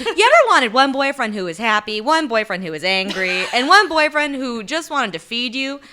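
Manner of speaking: loud, upbeat sales pitch; infomercial voice. Acted. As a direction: pitch it like a late-night TV ad. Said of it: "an infomercial".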